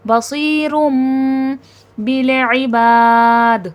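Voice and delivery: بالعباد very slow and chanted, the ba drawn long; slow tajweed chant with a bouncing dal at the end; Quranic recitation. Shown as a demonstration. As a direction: recite it slowly with the alif stretched. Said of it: "تھوڑا کھینچ کے پڑھا جائے گا".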